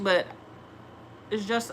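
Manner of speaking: usual speaking voice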